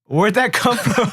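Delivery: 笑着问，语速放慢; laughing. Real, not acted. Laughing at an old memory.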